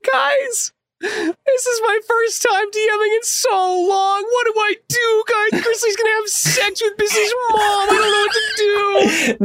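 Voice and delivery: high, whiny fake-crying wail; mock panic. DM wailing to his players in fake despair. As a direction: wail like a helpless new DM begging for help.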